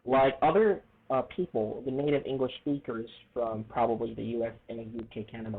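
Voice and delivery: normal talking voice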